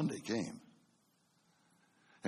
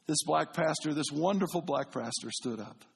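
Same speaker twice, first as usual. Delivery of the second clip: usual speaking voice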